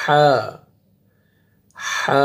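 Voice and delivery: ح as a long breathy haa from the throat. Shown as a demonstration. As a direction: push ح out through an open throat. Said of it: "گلے سے نکالنے آواز آپ نے". (گلے سے ح)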